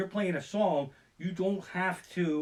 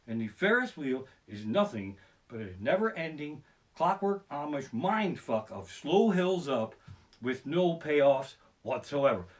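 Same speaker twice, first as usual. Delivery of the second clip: usual speaking voice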